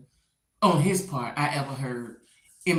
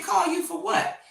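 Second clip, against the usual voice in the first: high, pointed comeback; sceptical retort; 12 semitones higher than usual. Retold or imitated. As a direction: challenge him with a raised voice. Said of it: "And she said".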